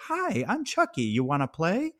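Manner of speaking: high, sing-song doll voice; creepy doll voice. Retold or imitated. Podcaster mimicking Chucky doll greeting, high sing-song. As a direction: chirp the toy line sweetly. Quoting the doll's line.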